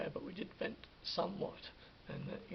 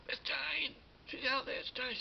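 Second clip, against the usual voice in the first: higher, urgent warning; a retold panic, pitched up; alarmed; 6 semitones higher than usual. Husband tells his wife the unwanted guest is back, alarmed. Warns Michelle that Jane is outside.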